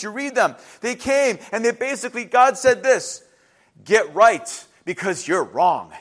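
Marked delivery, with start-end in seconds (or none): 3.8-5.9 slow, low, weighty delivery; slow pronouncement. Acted. Prophets delivering God's message to his people, slow and low. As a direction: lay it down slowly, pitch dropped.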